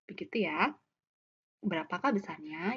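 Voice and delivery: usual speaking voice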